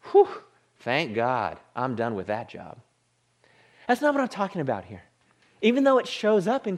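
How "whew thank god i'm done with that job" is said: low mock relief after a whew; put-on sarcastic gratitude, pitched low. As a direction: imitate someone relieved to be finished. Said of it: "they kinda say sarcastically".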